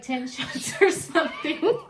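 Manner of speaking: ordinary talk, nothing marked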